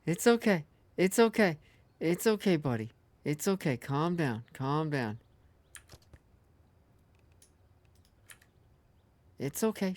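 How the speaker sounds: soothing a scared dog. Real, not acted. Soothing the boar.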